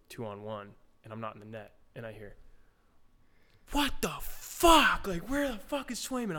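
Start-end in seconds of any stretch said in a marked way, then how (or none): 3.7-6.4 high, loud mock yell from a teammate; retold scream. Teammate yelling at the rookie goalie who left the net. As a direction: yell it like an angry teammate across the rink. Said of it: "Marshy's screaming at the top of his lungs".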